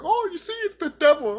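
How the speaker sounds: high, loud mock alarm; mocking imitation. Mimicking accusers in a rant.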